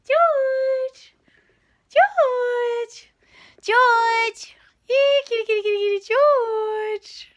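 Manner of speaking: high loud call to a cat. Real, not acted. Owner calling her cat and chanting kitty, loud high call.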